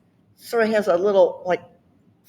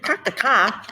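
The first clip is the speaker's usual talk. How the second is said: R-less Boston 'Park the car'; Boston accent; 3 semitones higher than usual. Shown as a demonstration. Repeating the Boston phrase for friends, Boston accent. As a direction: stretch 'car' to 'cah' like a Bostonian. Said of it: "They leave the R off".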